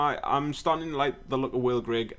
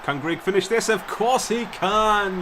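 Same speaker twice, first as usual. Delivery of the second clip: higher, slow triumphant call; excited call; 7 semitones higher, 5 dB louder than usual. Real, not acted. Calling the goal to viewers, triumphant. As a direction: build up and punch it.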